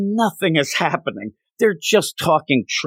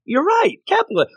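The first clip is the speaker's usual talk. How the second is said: laughing exclamation. Real, not acted. Mock outrage while laughing.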